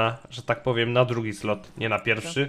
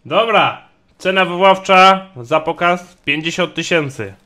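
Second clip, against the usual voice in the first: auctioneer; 4 semitones higher, 9 dB louder than usual. Acted. Announcing lot.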